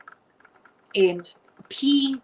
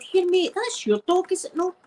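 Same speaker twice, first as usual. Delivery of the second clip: imitating a Ned; 10 semitones higher than usual. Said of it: "nudged them and said".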